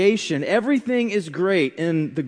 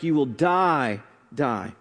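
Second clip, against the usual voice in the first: DIE stressed, then a lower, softer DIE; emphatic; 6 semitones lower, 3 dB quieter, slower than usual. Retold or imitated. Preacher repeats the emphatic Hebrew warning, gravely emphatic. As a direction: set each DIE apart, falling in pitch. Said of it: "it's emphatic".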